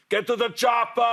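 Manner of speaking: high-pitched mock action-movie yell; imitated scream. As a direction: yell it like a fan in the street. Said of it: "scream out loud".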